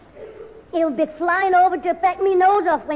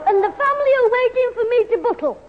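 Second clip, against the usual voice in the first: butler voice; 6 semitones higher than usual. Pretend. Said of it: "am the butler".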